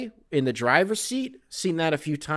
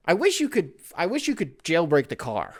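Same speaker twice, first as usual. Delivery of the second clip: normal talking voice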